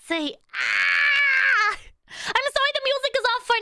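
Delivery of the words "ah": high loud playful "Ah!"; mock scream. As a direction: scream into the void with chat. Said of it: "Scream with me, chat".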